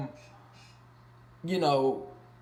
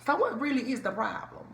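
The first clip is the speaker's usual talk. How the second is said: put-on higher-pitched try at a New Orleans accent; 10 semitones higher than usual. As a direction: lightly attempt a New Orleans twang. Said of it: "what's the New Orleans accent?".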